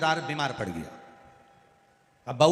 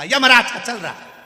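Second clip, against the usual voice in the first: furious loud snap, much higher, "Yamraj" hit hard; angry retort; 8 semitones higher, 12 dB louder than usual. Retold or imitated. Angry patient snaps back at the deaf visitor. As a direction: bark it back in anger. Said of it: "आ गई गुस्सा".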